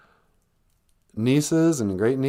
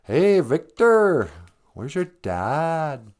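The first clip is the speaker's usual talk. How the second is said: put-on slow, high rez accent from Smoke Signals; 5 semitones higher, much slower than usual. Quoting the movie to a friend, slow high rez accent. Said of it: "smoke signals".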